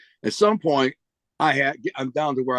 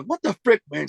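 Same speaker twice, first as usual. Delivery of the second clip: higher-pitched retold exasperation; 8 semitones higher than usual. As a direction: pitch jumps up in mock disbelief. Retelling his exasperation at the cashier.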